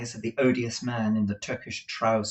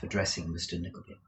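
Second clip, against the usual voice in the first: usual speaking voice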